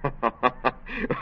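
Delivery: a quiet rising chuckle at being found out; a sheepish laugh, soft; short caught-out laugh. Acted.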